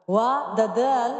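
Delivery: در دل drawn out, the دال bounced; qalqala on dal. Shown as a demonstration. Teacher demonstrating qalqala on the dal. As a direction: let the دال in دل echo with qalqala. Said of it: "قلقله باید صورت بگیره".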